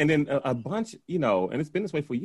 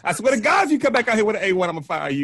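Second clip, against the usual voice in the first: louder, higher hammed-up threat; mock threatening boss; 6 semitones higher, 7 dB louder than usual. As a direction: threaten to fire her, playfully. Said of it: "So she's like".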